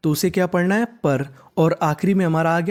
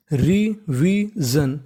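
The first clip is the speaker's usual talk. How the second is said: Revision stretched very slowly, rising at the end; slow model word; much slower than usual. Shown as a demonstration. Teacher modelling the word for students, very slowly. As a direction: draw out revision syllable by syllable. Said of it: "तो पूरी meaning बन गई".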